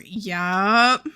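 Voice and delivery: normal talking voice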